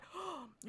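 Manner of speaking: sharp shocked gasp. Real, not acted. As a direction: gasp in disbelief. Shocked at the story.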